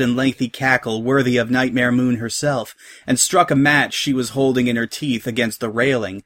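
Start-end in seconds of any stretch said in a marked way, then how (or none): none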